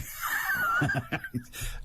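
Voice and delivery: a sudden high, breathless laugh. Real, not acted. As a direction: burst out laughing at yourself. Host laughs at own remark.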